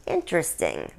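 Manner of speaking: low, soft musing; queen considering the news; pondering. Acted. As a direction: mull the word over quietly. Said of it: "the Queen pondered".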